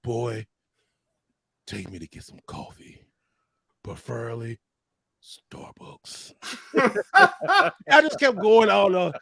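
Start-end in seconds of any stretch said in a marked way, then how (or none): 0.0-6.3 much lower, much softer, breathy whispered warrior voice; Kratos whisper. Retold or imitated. Demanding coffee from his friend, in a low whispered warrior voice. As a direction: hush it down low like a menacing warrior. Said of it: "I did the Kratos".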